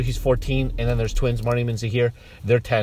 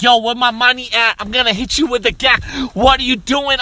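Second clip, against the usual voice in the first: loud, higher mock-rapper bluster; hammed-up gangster rapper; 5 semitones higher, 5 dB louder than usual. Uncle embarrassing his nephew, loud mock gangster voice. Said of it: "start rapping for the party".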